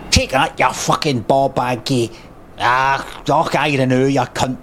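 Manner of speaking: lightly Scottish insult shouted as if landing a hit; a hint of Scottish brogue, tossed off; mild Scottish game taunt. Voice actor's first take of a Scottish game taunt, lightly Scottish. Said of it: "I want 'em to say a kinda Scottish".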